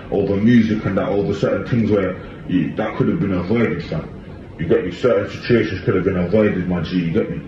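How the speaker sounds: normal talking voice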